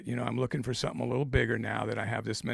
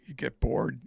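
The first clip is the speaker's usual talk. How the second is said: ordinary delivery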